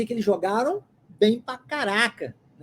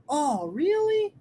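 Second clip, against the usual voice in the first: slow, drawn-out surprised question; amazed, lingering rise on really; 4 semitones higher, much slower than usual. Shown as a demonstration. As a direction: stretch it out in astonishment, pitch swinging up. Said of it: "é essa a expressão".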